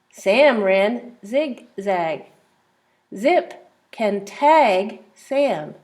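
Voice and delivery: very slow, word by word; slow reading. Shown as a demonstration. Teacher rereading all the pages slowly for the child. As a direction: read each word slowly. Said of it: "all the pages together".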